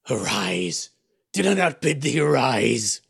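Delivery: a fiend insisting he rise; gibbering fiend. A narrator's reading. Fiend commanding the sleeper to rise, gibbering. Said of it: "the gibbering voice said again".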